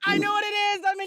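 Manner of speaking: sudden excited yell; excited shout. Real, not acted. Excited contestant blurts answer.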